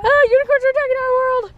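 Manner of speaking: high, fast playful creature squeal; unicorn voice. Pretend. Kids playing unicorn, playful voice.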